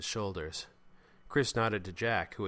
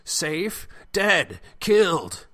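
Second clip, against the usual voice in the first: high, loud hysterical outburst; voice rising; 9 semitones higher, 12 dB louder, much slower than usual. A narrator's reading. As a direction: escalate from disbelief into panicked yelling. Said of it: "Jeremy's voice rose".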